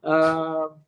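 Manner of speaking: ordinary delivery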